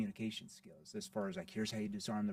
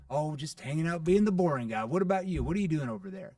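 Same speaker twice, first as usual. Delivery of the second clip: louder, teasing and lively; playful tone; 3 semitones higher, 8 dB louder than usual. Shown as a demonstration. Salesman joking with a prospect, playful and loud. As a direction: make them laugh at the boring guy line. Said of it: "I have to be playful".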